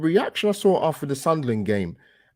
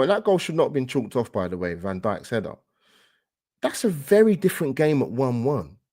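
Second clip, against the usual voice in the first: ordinary talk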